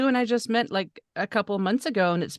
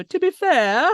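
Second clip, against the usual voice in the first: To be fair sung out higher and louder; Letterkenny catchphrase; 7 semitones higher, 7 dB louder, slower than usual. Retold or imitated. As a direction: echo it in the show's drawl. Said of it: "I just go".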